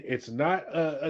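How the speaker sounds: ordinary delivery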